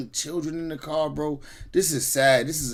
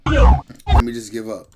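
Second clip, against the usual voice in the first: slow, louder resigned dad's thought; voicing the dad; 4 semitones lower, 8 dB louder than usual. Imagining the dad choosing to stop, slow resigned voice. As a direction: play the father giving in, slowly.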